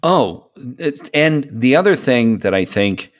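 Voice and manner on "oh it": usual speaking voice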